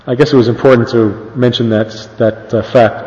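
soft, low wry aside; quiet aside. Real, not acted. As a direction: drop the voice for a dry joke.

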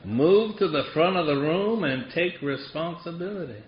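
imitated Southern drawl, dry advice; thick Southern accent. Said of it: "he had this thick Southern accent".

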